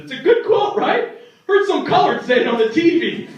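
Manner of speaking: chirpy grandpa. Retold or imitated. Grandpa's cheerful racist reply.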